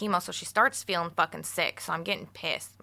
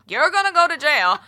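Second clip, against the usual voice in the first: high, loud imitated Filipino accent; 10 semitones higher, 11 dB louder than usual. Father-in-law warns her in Filipino accent. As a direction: scold in a Filipino dad accent. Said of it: "my father-in-law's Filipino, and he has an accent".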